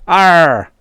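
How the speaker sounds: a pleased alien shout; happy native cry. Acted. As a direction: alien native crowing happily at his hit. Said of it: "said the native happily".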